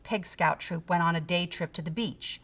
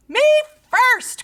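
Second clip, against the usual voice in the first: high, loud squeal; piggy cry; 9 dB louder than usual. A narrator's reading. Pig charging toward the sandwich, shrieking. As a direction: yell it while running. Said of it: "cried Pinkerton".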